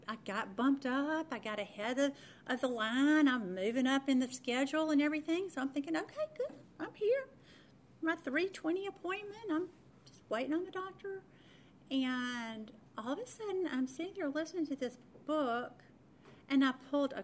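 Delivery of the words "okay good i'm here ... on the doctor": high, light retold self-talk; pleased inner voice. Patient telling herself she's next in line, pleased and high. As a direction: voice your contented thoughts up high. Retells her pleased waiting-room thoughts.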